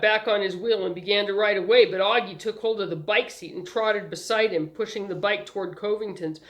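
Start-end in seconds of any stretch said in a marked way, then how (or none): none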